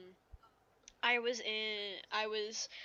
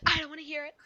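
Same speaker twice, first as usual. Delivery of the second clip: imitated teacher's abrupt dismissal, higher and louder; cutting off; 6 semitones higher, 5 dB louder, much faster than usual. Teacher interrupting a pupil's excuse, dismissive. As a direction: cut the pupil off, mildly sharp. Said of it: "she cut me off and said".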